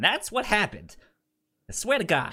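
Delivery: high, emphatic swearing-it's-true voice; a lightly voiced character swearing to God; high mock insistence. Podcaster mimicking a character insisting on their story, high and emphatic. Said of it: "they're like".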